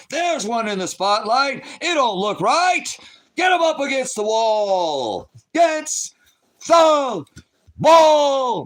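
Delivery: Trump at his rallies. Retold or imitated. Streamer mocking Trump at his rallies, high slow shouted impression. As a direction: bellow it like a rally crowd-baiter. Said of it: "This is Trump at his rallies".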